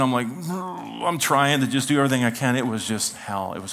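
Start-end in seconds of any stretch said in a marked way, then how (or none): 0.3-1.0 soft, sick murmur; holding back nausea, wordless; imitated queasy groan. Seasick reply, trying not to vomit.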